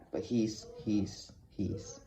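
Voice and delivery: a teacher lost for words, stammering and fading; stuttering. Acted. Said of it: "Mister P stuttered".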